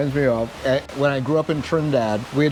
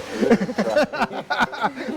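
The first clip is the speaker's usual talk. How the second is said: high-pitched burst of laughter; amused high laughter; hearty laugh; 9 semitones higher than usual. Real, not acted. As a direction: laugh it off in a long, high run. Joking they are lying to him.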